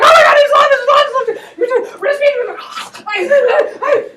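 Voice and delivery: high, loud, laughing panic; panicked shout. Retold or imitated. Yusupov bursting in, panicking that Rasputin's alive. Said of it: "He's like".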